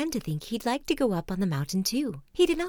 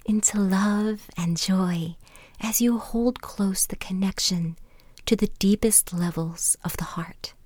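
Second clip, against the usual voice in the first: guided meditation; 5 dB louder than usual. Acted. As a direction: ease them gently inward, pausing between phrases.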